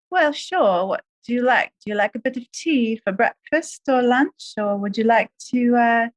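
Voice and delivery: British accent demo for the interviewer. Shown as a demonstration. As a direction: play the proper English host in a British accent. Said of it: "do a British accent?".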